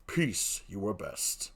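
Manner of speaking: low, hushed threat; quiet warning. Acted. Othello warns Emilia to be quiet, low threat. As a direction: drop deep and soft to silence her.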